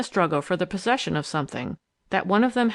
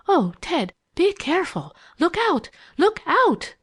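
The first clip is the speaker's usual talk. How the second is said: high, urgent cry, rising in force; alarmed warning; 11 semitones higher, 4 dB louder than usual. A narrator's reading. Eileen watching Ted in danger, involuntary high cry of alarm. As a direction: cry out a warning, higher each time. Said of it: "catch her breath ... and mutter involuntarily".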